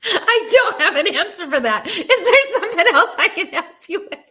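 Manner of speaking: laughing Siri impression; imitating Siri while laughing. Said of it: "Hey, Siri".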